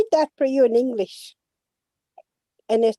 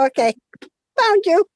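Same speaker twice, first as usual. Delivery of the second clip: relieved laugh; 9 semitones higher, 8 dB louder than usual. Real, not acted. Relieved at reconnecting guest.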